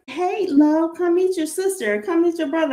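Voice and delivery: put-on a cheerful mom inviting her child over; imitating mom. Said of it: "She's all like".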